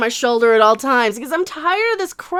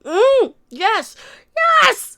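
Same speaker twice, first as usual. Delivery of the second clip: higher, delighted yes; excited approval; 8 semitones higher, much slower than usual. Real, not acted. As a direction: savor it then cheer. Cheering the clip.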